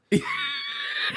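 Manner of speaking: high shrieking laugh. Real, not acted. Laughing at reporter exchange.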